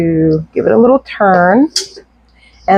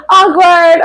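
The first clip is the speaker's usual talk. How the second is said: laughing squeal; 9 semitones higher, 5 dB louder than usual. Real, not acted. Self-mocking after a joke.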